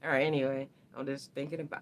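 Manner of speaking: ordinary talk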